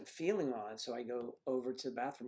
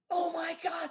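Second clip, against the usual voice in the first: much higher, louder mock panic cry; imitating wife's shriek; 7 dB louder than usual. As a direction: shriek it like she does in the car. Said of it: "So we're driving along there".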